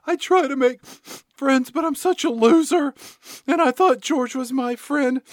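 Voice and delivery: hammed-up high, loud moping; mock crying. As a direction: imitate a mopey girl wailing in self-pity. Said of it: "Crystal is crying and moping".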